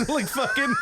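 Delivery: high squealing laugh. Real, not acted. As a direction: dissolve into laughter. Cracking up.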